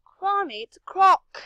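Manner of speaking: high, loud, botched Australian accent. Shown as a demonstration. Player showing off an Australian accent, badly hammed up. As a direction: exclaim in a hammed-up Aussie accent. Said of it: "I can do an okay Australian one".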